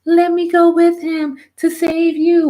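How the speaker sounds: put-on high, pleading girlish voice; mock Elizabeth begging to go. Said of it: "Of course she's gonna say that".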